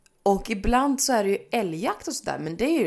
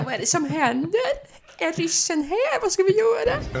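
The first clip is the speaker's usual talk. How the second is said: mock panic; 9 semitones higher, 3 dB louder than usual. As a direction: act scared the Russians came. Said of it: "leta reda på min far och säga". (låtsad panik inför pappa)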